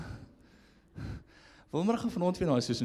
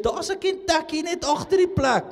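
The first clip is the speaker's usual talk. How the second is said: mock complainer; 11 semitones higher, 8 dB louder than usual. Mocking a doubter's complaint.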